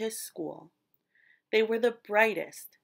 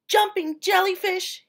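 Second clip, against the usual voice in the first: much higher, louder delighted squeal; 9 semitones higher, 8 dB louder than usual. A narrator's reading. Fish squealing at the glowing sea. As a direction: squeal it out in joy. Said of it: "he squealed".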